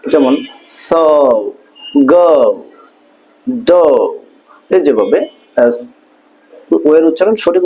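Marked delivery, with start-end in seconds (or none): 0.7-3.8 "স", "গ", "ডো" each stretched with an ou glide; correct O sound. Shown as a demonstration. Teacher giving example words, long gliding vowel in "স, গ, ডো". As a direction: draw out the vowel in "স", "গ", "ডো". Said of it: "উচ্চারণটা হবে অ".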